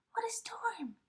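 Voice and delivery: very high, light bird voice; twittering wren. A narrator's reading. Wren twittering about the storm, high and light. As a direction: chirp it like a little wren. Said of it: "twitters wren".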